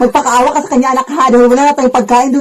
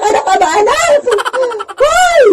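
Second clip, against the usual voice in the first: very high shouted hoy; shrieking scold; 12 semitones higher, slower than usual. Acted. Mother shrieks at her child, high shout.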